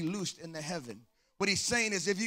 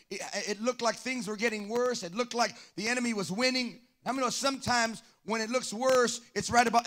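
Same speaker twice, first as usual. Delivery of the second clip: normal talking voice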